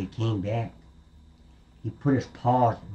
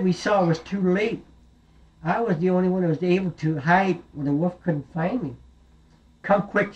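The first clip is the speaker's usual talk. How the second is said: little goat explaining; 7 semitones higher than usual. Acted. Goat tells mother what happened.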